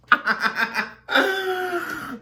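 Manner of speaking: loud, high-pitched cackle; big squealing laugh; burst of laughter. Real, not acted. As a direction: laugh out loud at your own joke. Laughs at own whispered joke.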